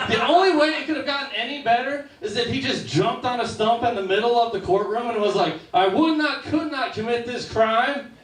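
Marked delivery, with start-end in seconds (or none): 5.7-8.1 much higher, slow, sing-song rhyming denial; Dr. Seuss rhyme. Retold or imitated. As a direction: bounce it like a children's storybook verse. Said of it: "the Doctor Seuss defense".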